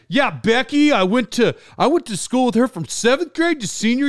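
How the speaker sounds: mock-amazed small talk, rambling on eagerly; over-friendly nerdy guy. Chatty guy marvels to Becky over her name, over-friendly. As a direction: imitate someone marveling at a trivial coincidence. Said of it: "why do people talk like this?".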